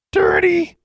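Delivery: high, loud bird squawk; squawking raven. A narrator's reading. Raven squawking at the girl in the bath. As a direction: screech it like a raven. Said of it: "squawked Charlie".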